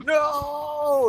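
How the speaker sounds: long, very high mock wail; drawn-out comic cry of despair; Andy Milonakis impression. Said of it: "Andy Milonakis is like".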